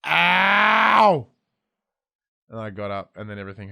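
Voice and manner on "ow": high, loud retold yelp of pain; a hammed-up yelp of pain; pained cry. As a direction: cry out like you're lying hurt on your back. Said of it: "lying on my back going".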